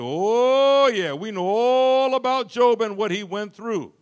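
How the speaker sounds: loud, high scoffing know-it-all; mock know-it-all. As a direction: wave it off like you've heard it all. Mocking know-it-all congregation.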